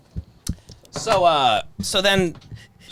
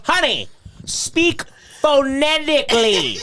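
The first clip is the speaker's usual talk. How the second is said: slow, higher, laughing through the line; laughing quote; 7 semitones higher, slower than usual. Retold or imitated. Retelling Stan's line to friends, laughing and slow. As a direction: repeat the line slowly while cracking up. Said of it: "he goes, he goes".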